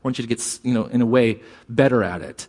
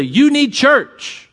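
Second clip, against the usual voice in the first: higher, slow and louder, pushing hard; blunt preachy pitch; 10 semitones higher, 4 dB louder, much slower than usual. Retold or imitated. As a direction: hammer it at a stranger like a pushy street preacher. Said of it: "We go up to people and say".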